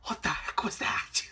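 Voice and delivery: hushed, startled aside; startled whisper. Real, not acted. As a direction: whisper it under your breath, thrown off. Startled aside at noise mid-story.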